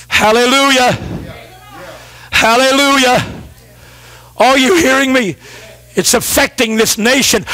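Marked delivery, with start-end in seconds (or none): none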